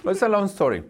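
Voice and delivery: talking through a mouthful of bread; words round a bite of food; mouth full. Real, not acted. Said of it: "with food in my mouth".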